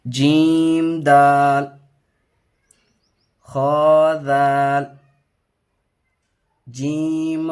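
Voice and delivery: drawn-out, sing-song reading of letter names; slow letter recitation. Shown as a demonstration. Teacher reading a Qaida lesson aloud, slow sing-song recitation. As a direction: chant each letter slowly for the pupil.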